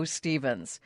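ordinary talk

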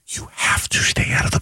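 hushed urgent whisper. Real, not acted. Analyst pressing co-host on avoiding the play-in, whispering. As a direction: whisper it like a secret warning. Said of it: "I don't know why I'm whispering".

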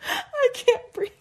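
high, slow, wheezing laughter; laughing so hard it turns to tears; crying laughing. Real, not acted. Sister crying with laughter over the windbreaker, real.

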